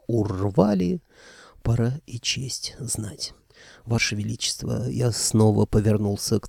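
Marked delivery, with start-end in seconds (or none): 1.6-4.9 soft unvoiced whisper. A narrator's reading. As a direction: breathe it barely voiced. Unvoiced quiet lines. (шёпотом)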